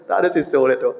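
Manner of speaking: ordinary talk, nothing marked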